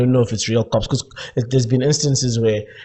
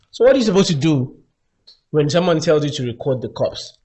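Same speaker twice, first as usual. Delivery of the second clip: ordinary talk, nothing marked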